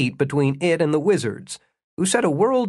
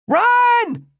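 high, loud warning yell; urgent shout; 5 dB louder than usual. A narrator's reading. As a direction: shout it to someone being chased, voice shooting up. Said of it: "Jimmy shouted".

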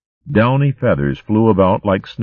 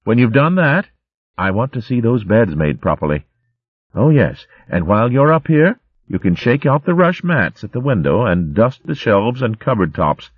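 mild scolding; 6 semitones higher than usual. Acted. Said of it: "still scolding".

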